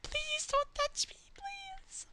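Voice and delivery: very high breathy whisper begging; pleading whisper. Pretend. Streamer begging an in-game creature, tiny whispered plea. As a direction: beg in a tiny scared whisper.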